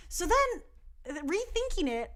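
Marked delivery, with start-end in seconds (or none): none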